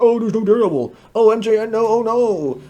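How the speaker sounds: high, loud mocking wail of complainers; mock whining. Vlogger mimicking fans upset about no Daredevil, mock whining. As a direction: imitate fans moaning, hammed-up and high-pitched. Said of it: "everybody else gonna be like".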